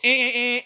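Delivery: high, loud repeated buzzer noise; bell ringing three times. Retold or imitated. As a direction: ring it three times, piercing. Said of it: "three times".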